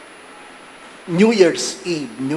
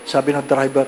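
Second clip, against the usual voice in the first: ordinary talk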